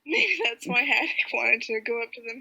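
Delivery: ordinary talk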